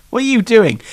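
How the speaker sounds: ordinary delivery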